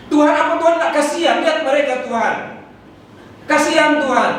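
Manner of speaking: high, scolding prayer at God; half-hearted yell of a demanding prayer; mock angry prayer. Preacher mocks a believer's angry shouting prayer. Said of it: "teriak-teriak marah-marah".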